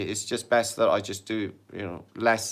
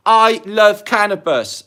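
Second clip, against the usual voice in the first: "I love cannabis" read out loud, high and slow; exclaimed comment; 10 semitones higher, 11 dB louder, slower than usual. Retold or imitated. Reading a viewer comment aloud, loud and drawn out. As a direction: belt out the viewer's comment with gusto.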